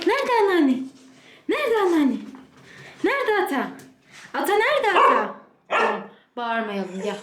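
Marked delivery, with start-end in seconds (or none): 0.1-5.3 much higher, eager "where is she" call; excited search game. Real, not acted. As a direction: get the dog hunting for grandma. Exciting dog to find family.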